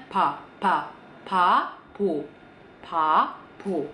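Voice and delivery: "ba, babo" sounded as slow "pa, pa-bo"; clearer P onset. Shown as a demonstration. Teacher breaking down the word, slow P-like opening. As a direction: split "babo" slowly, first sound puffed like P. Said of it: "P-nya rada lebih jelas".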